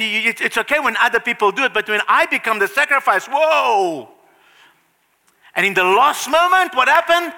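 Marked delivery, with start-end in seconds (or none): 3.3-4.1 mock alarm. Imagining becoming the sacrifice.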